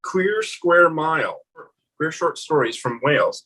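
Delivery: usual speaking voice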